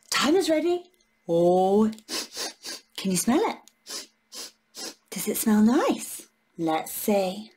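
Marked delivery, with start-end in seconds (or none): none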